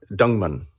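Dungman said slowly, no final S; slow Dungman, S dropped; Belgian pronunciation. Shown as a demonstration. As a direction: say Dungman with a silent S. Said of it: "the S is silent".

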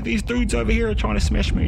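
high-pitched, worked-up protest; agitated insistence. Retold or imitated. Student telling his mate he's under threat, agitated insistence. Agitated explanation during acted quarrel.

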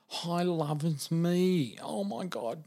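high, drawn-out, emotional voice; overcome, as if crying; imitated tearful phone call. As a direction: act out her crying down the phone. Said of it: "She started to cry".

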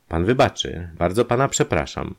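usual speaking voice

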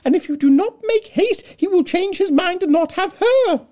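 very high, loud, frantic call to her husband; Mrs Bennet calling out. Acted. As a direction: burst in crying for help at full volume. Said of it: "called out as she entered".

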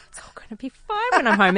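tipsy whisper to yourself. Retold or imitated. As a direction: hush it, a wine-soaked thought. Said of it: "and you think".

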